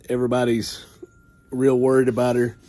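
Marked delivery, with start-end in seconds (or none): none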